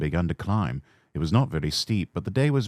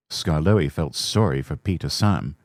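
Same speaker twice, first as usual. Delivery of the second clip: usual speaking voice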